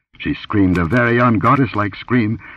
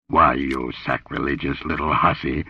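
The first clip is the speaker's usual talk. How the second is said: low rumble of rebuke, pitched down; rumbling; 4 semitones lower, 4 dB quieter than usual. A narrator's reading. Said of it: "rumbled Conan".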